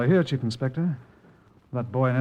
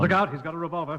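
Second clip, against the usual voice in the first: raised, urgent cry of alarm; alarmed warning; 6 semitones higher, faster than usual. Acted. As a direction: warn your chief of the gun. Suspect draws gun.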